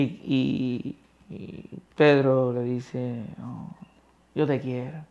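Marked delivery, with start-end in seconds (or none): 3.4-5.0 softer, held back; humble answer. Acted. Humbled Peter answering Christ, soft and low. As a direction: admit it small.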